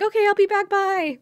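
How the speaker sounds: very high, loud comic goodbye; panicky mock exit. Haruka running after the storming friend, high hammed-up squeal. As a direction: squeal a hurried excuse to leave.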